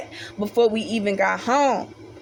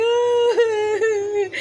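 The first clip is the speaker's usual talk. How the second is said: high hammed-up sob; a short wailing cry; mock crying; 10 semitones higher than usual. Narrator imitates her own cry on the stairs, mock sob. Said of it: "crying like".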